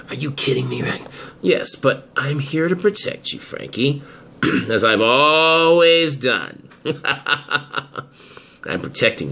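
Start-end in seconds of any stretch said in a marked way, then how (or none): 0.1-1.0 disbelieving whisper. Acted. Frankie shaken, disbelieving. 6.8-8.0 smug villain chuckle. Acted. Mitch gloating over Frankie.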